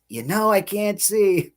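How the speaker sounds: high, drawn-out "You know, I can't see"; mom's extra emphasis. Retold or imitated. Son mimicking mom's complaint, "You know, I can't see" overemphasized. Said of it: "put that extra emphasis".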